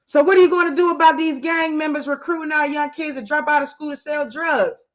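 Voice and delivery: upset teacher. Acted. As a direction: demand an answer, sharply upset. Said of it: "he obviously was upset".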